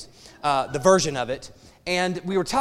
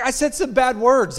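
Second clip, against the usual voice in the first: son confessing; 8 semitones higher, 7 dB louder than usual. Retold or imitated. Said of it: "so he's like".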